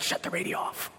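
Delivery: put-on whispered plea from a kid with a headache. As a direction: hush it out as if any noise hurts. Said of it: "they're like".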